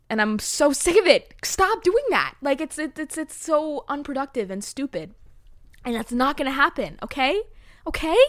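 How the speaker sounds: loud, high scolding of her own brain; fed-up pep talk. Real, not acted. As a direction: snap at yourself to cut it out, then pump yourself up. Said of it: "I just needed that pep talk".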